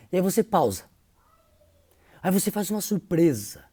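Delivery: ordinary delivery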